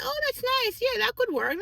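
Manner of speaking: high, sweet, agreeable; a high, pleasant yes-voice; high polite agreement. Retold or imitated. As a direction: go up high and nice to please her.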